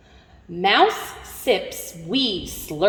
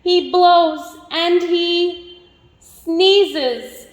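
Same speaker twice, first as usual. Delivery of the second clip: slow; 8 semitones higher, 4 dB louder, much slower than usual. Acted. Drawn out after sneeze.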